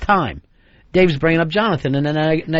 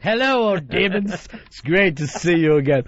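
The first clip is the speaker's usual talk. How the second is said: hammed-up hello to old friends; mock fond greeting. Host greets his old demons on vacation, mock delighted.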